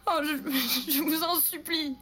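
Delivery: a desperate cousin weeping as she begs; crying and stammering. A narrator's reading. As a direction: beg through sobs, stumbling on the words. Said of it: "Elle pleurait et bégayait".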